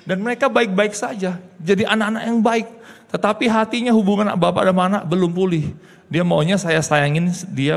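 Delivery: high, loud pleading sermon; impassioned preaching. Acted. Preaching emphasis. (khotbah penuh emosi, keras dan tinggi)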